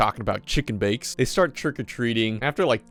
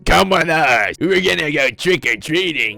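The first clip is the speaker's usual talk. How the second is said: loud, raised monster voice; demanding pumpkin heads; 3 semitones higher, 4 dB louder than usual. Acted. Pumpkin heads ordering kids to follow them, demanding. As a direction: bellow it as a hammed-up bossy creature. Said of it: "They're just demanding these kids".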